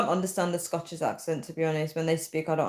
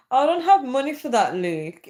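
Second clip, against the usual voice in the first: playful protest; 7 semitones higher, 5 dB louder than usual. Real, not acted. Protesting to the viewer Luke.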